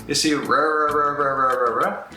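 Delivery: rá with an English R, strange; wrong English R in rá. Shown as a demonstration. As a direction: voice the rá like an English speaker. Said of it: "é muito estranho".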